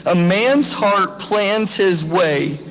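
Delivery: slow, deliberate reading of the verse; slow scripture reading. Shown as a demonstration. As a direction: read each word of the verse slowly. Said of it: "Proverbs sixteen nine says".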